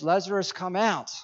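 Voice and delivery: imitating a really loud voice; loud command. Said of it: "in a really loud voice".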